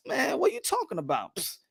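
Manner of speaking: soft, dismissive mutter of a brush-off; acting out a quiet, offhand dismissal; brushing her off. Promoter waving off a fighter's mom, quietly dismissive. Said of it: "like, like brushing her off".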